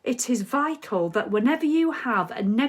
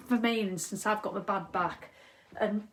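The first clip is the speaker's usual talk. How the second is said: usual speaking voice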